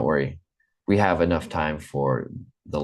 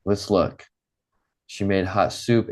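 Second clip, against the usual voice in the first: ordinary delivery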